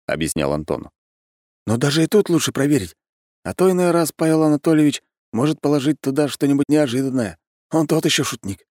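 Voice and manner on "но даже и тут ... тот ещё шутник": high-pitched advising character voice; Anton cautioning. Acted. Anton warning friends about the cake maker, high explaining voice. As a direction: advise them, voice pitched well up. Anton continues his advice.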